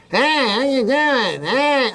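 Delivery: high, slow, drawn-out hey-greeting; hammed-up high drawl, parodying oneself; self-impression. Shown as a demonstration. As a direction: exaggerate your own voice, stretching each word up high. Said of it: "I can do an impression of myself".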